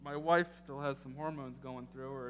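normal talking voice

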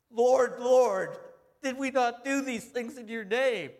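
high, loud, desperate cry of the damned; frantic begging. Retold or imitated. Preacher voicing the damned begging the Lord, frantic high cry. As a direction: plead for your life, voice cracking high.

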